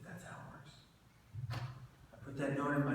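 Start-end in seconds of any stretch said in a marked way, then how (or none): 0.1-0.7 a bare whisper, almost no voice; hushed whisper. Retold or imitated. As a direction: breathe the words out softly. Quiet line from a suicide note.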